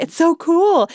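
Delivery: put-on high, loud, thrilled kid voice; little brother's excitement. Little brother gushing to mom about his comic book, high and excited. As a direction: squeal it like an excited little boy. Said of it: "He's like".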